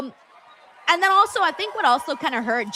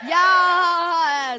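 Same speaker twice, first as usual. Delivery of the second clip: excited; 5 semitones higher, 4 dB louder than usual. Real, not acted. Cheering a follower.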